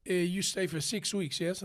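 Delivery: put-on broken English with an Italian accent. Hotel clerk checks in the tenor, Italian accent.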